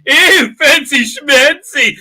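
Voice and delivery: high, loud, drawn-out teasing coo; daughter's mock-impressed cry. Daughter to a smartly suited stranger in the elevator, mock-impressed. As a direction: tease him with loud, stretched-out mock admiration. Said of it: "this crazy guy going".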